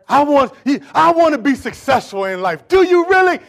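loud, high challenge; full-force preaching. Acted. Preacher challenging those who want success, full-force preaching. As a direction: burst out loudly and challenge them.

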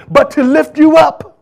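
high, loud shout of lift you up; preaching shout. Acted. Preacher shouting encouragement to the church. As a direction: lift them with a rising cry.

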